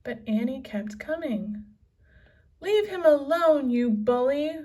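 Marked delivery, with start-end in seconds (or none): 2.6-4.6 high, loud and furious; angry cry. A narrator's reading. Annie yelling at the pirate, high angry cry. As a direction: scream it at the bully. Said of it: "she cried".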